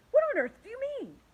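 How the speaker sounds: high, loud, fast disbelief; alarmed demand. Acted. The creatures demand an answer of the ladybug, high and alarmed. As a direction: fire it back in shock.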